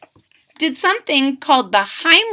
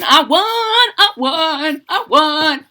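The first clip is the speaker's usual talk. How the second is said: 1 gleeful celebration; 4 semitones higher, 4 dB louder, slower than usual. Acted. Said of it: "He's having a little celebration".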